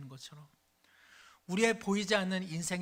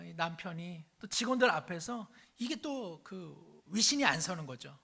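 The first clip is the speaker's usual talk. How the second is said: ordinary talk, nothing marked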